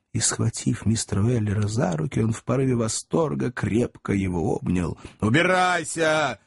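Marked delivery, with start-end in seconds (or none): 5.2-6.4 shout. A narrator's reading. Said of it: "крикнул Сэм".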